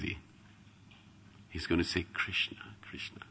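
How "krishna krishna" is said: breathy whisper of the name; whispered Krishna, Krishna. Acted. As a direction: whisper it tenderly. Voicing Chaitanya's inner calling.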